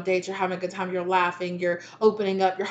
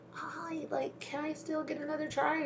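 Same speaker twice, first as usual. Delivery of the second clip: timid soft plea; 7 semitones higher, 11 dB quieter than usual. Retold or imitated. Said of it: "they're like".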